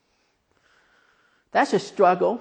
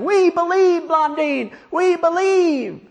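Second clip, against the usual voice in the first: high, drawn-out mock crowd yell; 13 semitones higher, 5 dB louder, slower than usual. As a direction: holler it like a fervent crowd. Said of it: "the whole crowd is yelling".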